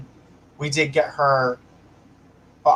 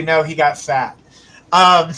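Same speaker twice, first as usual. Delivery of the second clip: usual speaking voice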